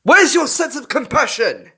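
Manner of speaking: loud, high demand for compassion; indignant. A narrator's reading. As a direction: scold him for his cruelty. Plucky confronting the fox.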